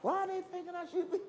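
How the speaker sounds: high, quick bewildered question; puzzled wonder. Retold or imitated. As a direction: ask it lightly amazed, pitch up. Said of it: "And I'm wondering".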